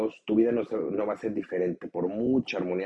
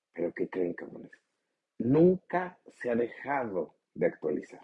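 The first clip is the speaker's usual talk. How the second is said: normal talking voice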